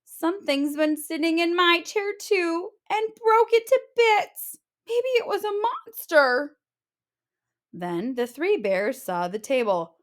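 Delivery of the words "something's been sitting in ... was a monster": high little cub voice; small cub telling of broken chair; little bear. Acted. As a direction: sound small and alarmed.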